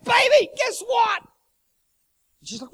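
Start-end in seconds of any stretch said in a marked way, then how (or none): 0.0-1.2 high, loud, slow burst of excitement; bursting to tell his wife; squealing with excitement at dawn; excited news. Retold or imitated. Excited husband wakes wife.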